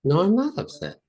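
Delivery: very high, hammed-up protest; squeaky-high back-and-forth, stretching the words; exaggerated upset. Shown as a demonstration. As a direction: play both sides in a high put-on voice. Escalating acted upset in exercise.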